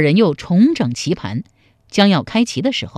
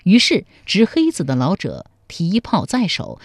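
usual speaking voice

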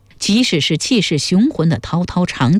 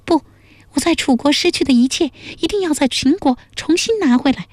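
high-pitched princess, refusing without hesitation; high character voice, firm resolve; resolute princess; 11 semitones higher than usual. A narrator's reading. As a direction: voice a determined princess, pitched far up.

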